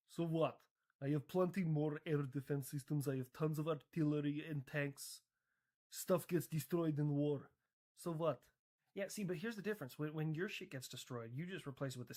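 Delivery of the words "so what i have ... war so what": dismissive mock Russian accent. Russia shrugs off lost equipment, in a mock Russian accent. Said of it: "Anyways, Russia".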